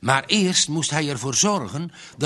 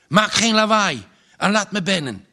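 urgent call; 6 semitones higher, 4 dB louder than usual. A narrator's reading. Man urging neighbour to open door.

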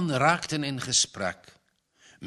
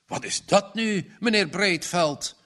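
higher, astonished question; be caught off guard; surprised; 7 semitones higher, 3 dB louder than usual. A narrator's reading. Salesman spots half-built car, surprised. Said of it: "vroeg hij verbaasd".

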